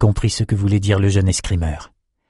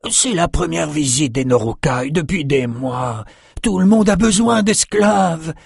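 much higher, put-on merchant voice; higher put-on voice of a complaining noble; Quentin's voice; 8 semitones higher than usual. A narrator's reading. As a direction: whine about runaway slaves.

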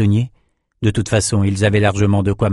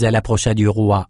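ordinary delivery, nothing marked

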